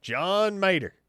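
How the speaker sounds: loud, high cartoon character holler; high, booming comic impression; Larry the Cable Guy. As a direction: belt the name in a hammed-up impersonation. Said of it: "That's the Larry the Cable Guy voice".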